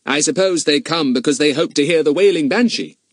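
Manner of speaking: put-on eager boy voice; Fatty, keen boy. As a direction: pitch it up, politely probing. Fatty chatting up the owner.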